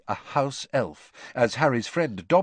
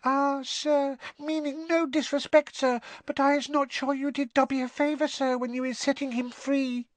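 very high squeaking voice; a house elf meeting someone famous; tiny elf squeak. Acted. Winky introducing herself to Harry, awed squeak. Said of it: "a teeny quivering squeak of a voice".

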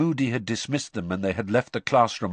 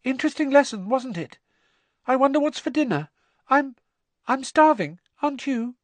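unnaturally high voice; 4 dB louder than usual. Acted. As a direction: voice jumps way up, strained and false. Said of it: "his voice much higher than usual".

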